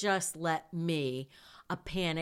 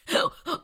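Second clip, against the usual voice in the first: sharp laugh; 14 semitones higher, 7 dB louder than usual. Real, not acted. Laughs at own hysterical anger.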